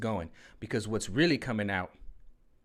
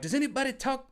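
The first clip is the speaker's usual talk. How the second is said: much higher prim mock voice; mock flight attendant. Vlogger mimics the Airplane movie scene, high prim voice. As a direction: ask it in a high, proper little voice. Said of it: "some guy was talking jive".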